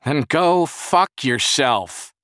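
loud, high, drawn-out yell; furious yell back at a friend. A narrator's reading. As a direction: shout angrily through the door. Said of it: "Jerry yelled back".

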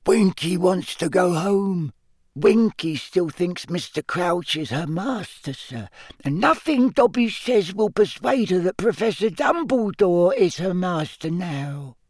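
a little servant elf grieving for a friend; sad elf voice. A narrator's reading. Dobby tells Harry sadly about Winky in a high elf voice.

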